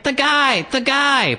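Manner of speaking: very high mock-wailing child; crying toddler. Girl dragged off by mother, mock wailing. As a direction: wail it like a dragged-away toddler. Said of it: "She starts crying".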